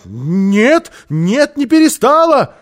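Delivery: loud, high, out-of-control denial; frantic shout. A narrator's reading. Housekeeper denying to Karlsson, frantic shout. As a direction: shout it, losing your head. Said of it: "закричала она, совсем потеряв голову".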